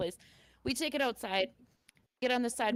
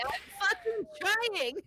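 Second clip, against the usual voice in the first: high, stretched-out helpless wail; mock scream; 9 semitones higher, slower than usual. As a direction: replay the desperate yell, high and slow. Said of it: "screaming for my kid".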